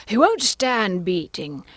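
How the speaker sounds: high-pitched, animated Hatter voice; Mad Hatter. Acted. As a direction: play an excitable Hatter, high and loud.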